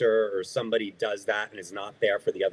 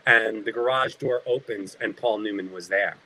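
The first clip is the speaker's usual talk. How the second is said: ordinary talk, nothing marked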